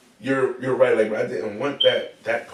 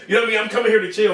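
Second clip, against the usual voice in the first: higher and louder, pressing the point; emphatic insistence; 9 semitones higher, 5 dB louder, much faster than usual. Real, not acted. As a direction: insist you only came to chill. Insisting he just wants to chill.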